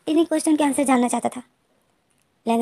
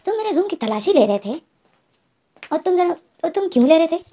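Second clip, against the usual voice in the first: angry; 5 semitones higher than usual. Acted. Wing angrily confronts Lan Jan about the search. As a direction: demand answers, voice climbing in anger. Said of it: "गुस्से से देख रहा था".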